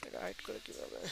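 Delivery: whispered aside to himself; whisper to self. Real, not acted. Teacher whispering to himself while hiding a window. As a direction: breathe it under your breath, puzzled. Talking to himself, fiddling with software.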